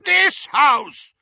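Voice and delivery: furious uncle shrieking. A narrator's reading. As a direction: explode with rage. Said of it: "shrieked Uncle Vernon".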